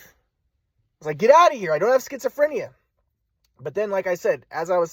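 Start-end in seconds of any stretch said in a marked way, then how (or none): 1.2-2.7 retold yell. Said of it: "I yelled at them to leave".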